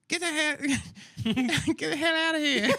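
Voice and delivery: high laughing mock guard. Guard shooing them out, laughing high. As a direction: laugh through the guard's line.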